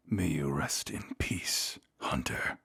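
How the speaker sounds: slow, hushed whisper; soft, drawn-out deathbed whisper; whispered farewell. Acted. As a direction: whisper it like a last rite. Vampire's farewell before biting the hunter.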